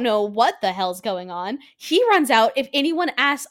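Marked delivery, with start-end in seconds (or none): none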